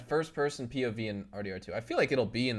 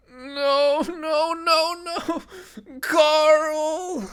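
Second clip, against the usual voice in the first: very high, loud anguished cries; Andrew Lincoln as Rick; 9 dB louder, much slower than usual. Retold or imitated. Streamer imitating Rick crying for his son, very high. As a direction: wail Carl's name in mock despair. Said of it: "Sounds like Andrew Lincoln".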